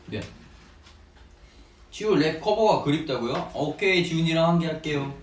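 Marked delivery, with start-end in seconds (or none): none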